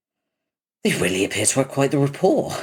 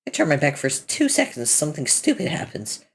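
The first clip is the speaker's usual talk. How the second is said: normal talking voice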